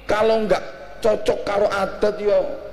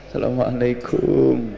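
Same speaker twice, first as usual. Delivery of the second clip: slow, humble greeting to a kiai; bow your voice down respectfully, slowly; humble student greeting; 4 semitones lower than usual. Shown as a demonstration. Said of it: "Santri nang kiai". (salam santri sowan kiai, alon lan andhap asor)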